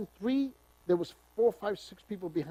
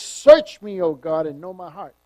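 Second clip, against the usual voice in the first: a sudden loud burst on search; loud preaching; 14 dB louder than usual. Acted. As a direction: call it out to God. Preacher reading psalm.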